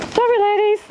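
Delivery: very high sweet apology to hens; talking to pets. Real, not acted. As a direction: coo an apology to the chickens.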